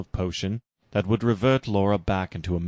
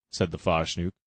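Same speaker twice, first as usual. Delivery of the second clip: plain reading voice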